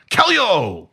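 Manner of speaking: high, drawn-out call across the room; yelled command. Acted. Master stopping his student mid-throw, yelled command. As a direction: call out the order like a martial arts master. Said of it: "my master, yells out".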